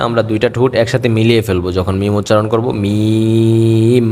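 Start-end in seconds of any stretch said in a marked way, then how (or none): 2.8-4.1 মিম drawn out slowly with both lips pressed together; mim with closed lips. Shown as a demonstration. Teacher showing students how to close the lips on মিম. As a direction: hum মিম long through closed lips. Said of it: "দুইটা ঠোঁটকে আমরা একসাথে মিলিয়ে ফেলবো".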